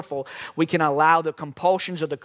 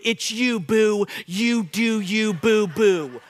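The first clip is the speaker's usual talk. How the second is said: slow, drawn-out mock "you do you, boo"; mocking pop culture; much slower than usual. Preacher mocking the culture's slogan to the church, drawn-out. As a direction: tease the culture's slogan, lightly. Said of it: "the concept in our culture is just follow your heart".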